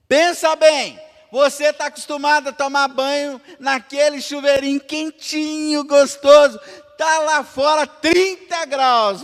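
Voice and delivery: high, loud fervent preaching; preaching at full force. Acted. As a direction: drive it home to the church. (pregação inflamada e alta)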